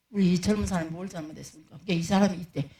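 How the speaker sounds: ordinary talk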